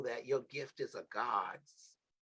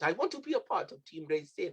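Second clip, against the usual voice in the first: a hint of a Jamaican lilt, pitched higher; mock Jamaican accent; 5 semitones higher than usual. Host imitating young Krilios asking to join, mock Jamaican accent. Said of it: "Not that being my accent".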